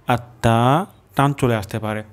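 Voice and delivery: usual speaking voice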